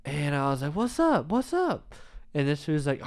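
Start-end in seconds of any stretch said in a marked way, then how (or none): 0.8-1.9 high-pitched mock yell; imitated yell, no real volume; retold screaming challenge. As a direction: re-enact calling out a rival, voice pitched way up. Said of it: "Yeah, screaming".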